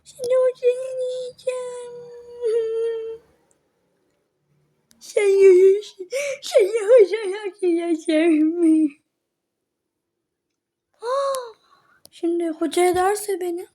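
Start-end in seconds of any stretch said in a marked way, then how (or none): 0.0-9.0 high, loud crying threats. Acted. A bullied girl sobbing threats at her classmates, loud crying. 11.0-11.5 sharp gasp. Acted. Sudden fright.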